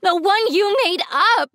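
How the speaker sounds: anguished high-pitched outcry; upset yelling. Acted. Daughter confronts her dad over the lie, upset yelling. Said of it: "I yelled".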